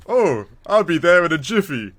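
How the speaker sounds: a hint of a breezy hero promising to hurry; mock suave agent. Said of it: "being the manliest, badass agent".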